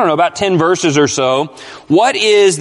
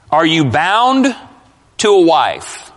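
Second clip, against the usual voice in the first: slow, phrase by phrase with pauses; deliberate reading; much slower than usual. Real, not acted. Preacher setting a verse before the congregation, slow and deliberate. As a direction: read the verse out very slowly, breaking after BOUND. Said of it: "I'm gonna stop there".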